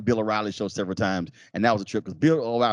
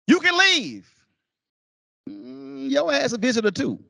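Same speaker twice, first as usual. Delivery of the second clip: dismissive heckler. Retold or imitated. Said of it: "folks like".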